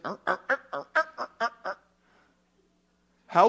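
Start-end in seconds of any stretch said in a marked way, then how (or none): 0.0-1.7 string of short repeated "uh" noises; imitated relatives' grunting. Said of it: "going down to that place and just".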